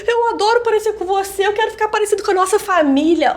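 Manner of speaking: voz aguda e embargada; tearful. Retold or imitated. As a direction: fala emocionada quase chorando. Filha chorando ao telefone. (filha chorosa declarando amor ao pai)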